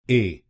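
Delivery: "ए" as a short e sound on its own; first syllable demo. Shown as a demonstration. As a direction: say "ए" clearly, a short e. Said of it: "बोलिए ए".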